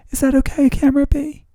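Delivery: high, loud mock-sweet question; playful high-pitched check-in. Host asking the second camera's permission, playful high voice. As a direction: ask it up high, pushed and playful.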